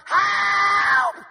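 high, loud mock cry for help; imitated scream for help. Pastor imitating a girl stranded on a boat, screaming. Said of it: "she's like".

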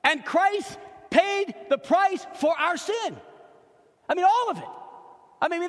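high, loud preaching at full force; impassioned preaching. Real, not acted. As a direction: drive each phrase home to the congregation.

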